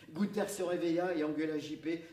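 ordinary delivery

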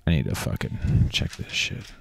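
fast, soft muttering; muttered aside. Real, not acted. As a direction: grumble it under your breath.

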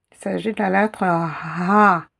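ordinary talk

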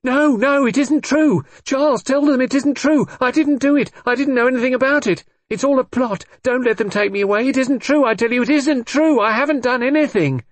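loud, very high panicked protest; hysterical screaming. A narrator's reading. Brenda going to pieces on arrest, screaming hysterically. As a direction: scream the denial, pleading and frantic. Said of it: "She screamed, she clung to me, she cried out".

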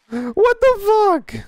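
high, slow stretched what the fuck; shrill disbelief. Real, not acted. Gamer squealing in shock at the crash.

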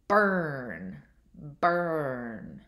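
BURN drawn out, low and falling; careful word model. Shown as a demonstration. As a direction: stretch BURN so the middle sound is heard. Teacher models test word for listening.

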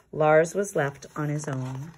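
plain reading voice

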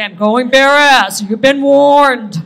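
loud, slow proclamation; dad proudly announcing he's going bare ass; father's announcement. Retold or imitated. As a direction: announce it like a town crier.

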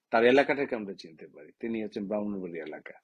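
ordinary talk

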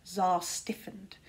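ordinary delivery, nothing marked

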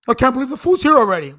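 fast, loud whiny mockery; mock Ken griping the food came too quickly. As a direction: rattle off a snotty complaint. Mocking Ken at Applebee's.

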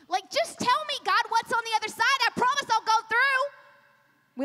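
high, urgent put-on bargaining; mock pleading. Believer bargaining with God at the door, mock pleading. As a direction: plead and promise in a hammed-up way.

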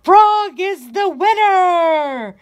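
excited cry. A narrator's reading. Cow and sisters cry out that Frog wins, excited. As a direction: shout the winner with delight. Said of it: "they cried".

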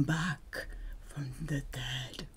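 hushed eerie whisper; spooky whisper. Acted. Teacher whispering about the returning dead, spooky whisper. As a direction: whisper it like a ghost story.

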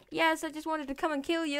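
normal talking voice

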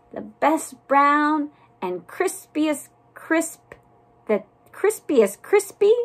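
high, slow, proud bragging; self-admiring storybook cookie; boastful cookie. A narrator's reading. Boastful cookie praises itself.

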